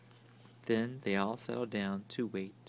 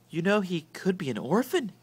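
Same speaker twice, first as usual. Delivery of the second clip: hissed; 6 semitones higher, 4 dB louder than usual. A narrator's reading. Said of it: "hissed Aunt Figgy".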